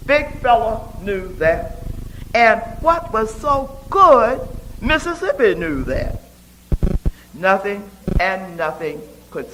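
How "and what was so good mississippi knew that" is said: ordinary delivery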